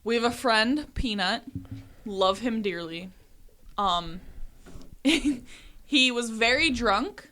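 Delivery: ordinary delivery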